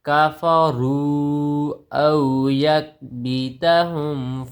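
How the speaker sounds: slow, drawn-out melodic chant; Quran recitation. Acted. Teacher reciting the verse for students, slow melodic tilawat. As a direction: intone each word long and sung.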